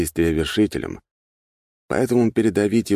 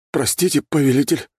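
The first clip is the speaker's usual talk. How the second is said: higher-pitched, mildly flustered servant; flustered apology; 10 semitones higher, 3 dB louder than usual. A narrator's reading. Werewolf apologises to his master after a mishap, mildly flustered. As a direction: apologise in a raised, bewildered voice. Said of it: "растерянно пробормотал оборотень".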